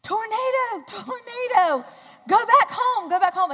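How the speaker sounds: high, loud shout of alarm, imitating a man; hollering. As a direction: holler a warning across traffic. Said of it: "starts hollering".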